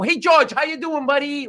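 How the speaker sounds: high, exaggerated hello to a buddy; mimicked over-friendly holler; mock hearty greeting. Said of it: "I can't go".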